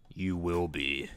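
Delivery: low, slow, oily predator voice; a sleazy groomer coaxing a girl; creepy older man voice. Acted. Groomer messaging a young girl, low creepy voice.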